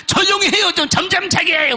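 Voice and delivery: angry complaint. Retold or imitated. Deacon kicks the door over lost sleep, imitated high complaint. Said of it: "이 집사가 오더니".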